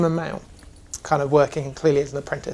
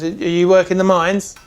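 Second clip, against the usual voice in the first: dwarf smith asking; 4 semitones higher, 7 dB louder than usual. Acted. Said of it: "The dwarf actually puts down his belly and goes".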